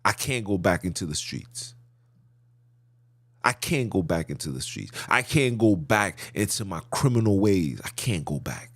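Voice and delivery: earnest prayer. Retold or imitated. Retelling own earnest prayer to Father.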